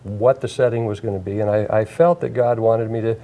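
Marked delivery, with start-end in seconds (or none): none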